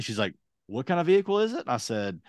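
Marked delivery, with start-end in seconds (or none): 0.7-1.6 higher mock clerk question; bored clerk. Tow lot woman questioning the customer, voiced higher.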